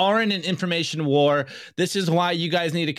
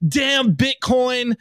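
mildly hammed-up cursing, higher and drawn out; mock exasperation; 7 semitones higher, 3 dB louder, much slower than usual. Podcaster voicing a fallen cyclist in a meme, mock exasperation. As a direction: drag it out slowly like a mock lament.

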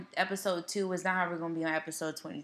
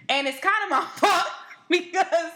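laughing; 8 semitones higher, 4 dB louder than usual. Real, not acted. Laughing at own confession.

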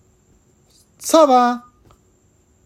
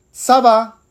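bright, higher "Ça va" between friends; casual friend; 8 semitones higher, 5 dB louder than usual. Acted. Carlos answering his friend Pedro, casual French. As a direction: toss it off like a buddy. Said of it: "Carlos y Pedro".

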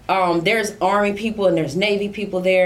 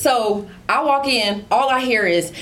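ordinary talk

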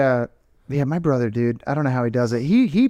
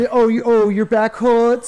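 mocking whiny sympathy; 9 semitones higher, slower than usual.

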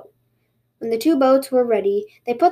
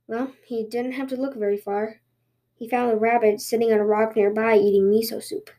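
ordinary delivery

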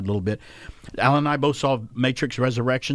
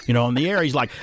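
ordinary delivery

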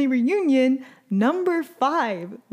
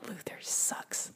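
unvoiced sigh-whisper, slow; whispered aside; 15 dB quieter, slower than usual. Real, not acted. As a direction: breathe it out like a secret jab. Aside after sigh about Luther.

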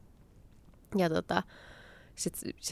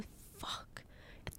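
breathless whispered thought, much softer; whispered curse; 21 dB quieter than usual. Retold or imitated. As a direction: whisper the swear to yourself in dismay. Panicked inner thought while exhausted.

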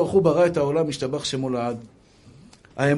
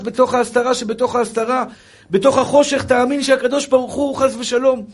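high, loud, fervent sermon; impassioned preaching; 9 semitones higher, 5 dB louder than usual. Real, not acted. Rabbi urges faith amid hardship, impassioned preaching. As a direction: preach it urgently, voice raised high. Preacher exhorts at full force.

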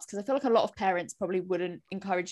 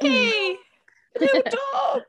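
high, loud squeal at a puppy; cooing shout to a tiny dog; excited dog greeting; 7 dB louder than usual. Real, not acted. Greeting a little dog arriving.

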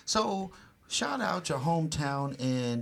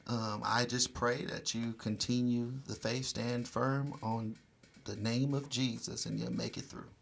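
prayer; 2 semitones lower, 3 dB quieter than usual. Acted. Prays over listeners.

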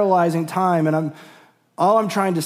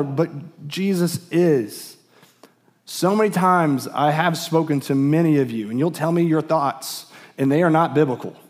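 normal talking voice

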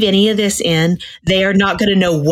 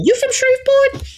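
much higher, squeaky cartoon Cajun firefly; firefly character voice. Quoting the movie firefly to friends in a high cartoon voice. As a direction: pop the question up high like a tiny bug. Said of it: "And he goes".

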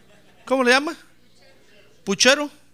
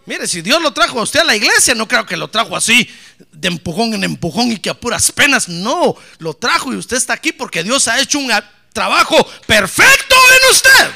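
preach; 15 dB louder than usual. Real, not acted. (predicación a toda voz, cada vez más fuerte)

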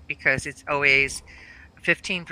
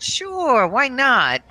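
much higher, bright sarcastic yes; mock agreement; 8 semitones higher, 3 dB louder, much slower than usual. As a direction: toss it back with sarcastic cheer. Mom's mock agreement to cheeseburger suggestion.

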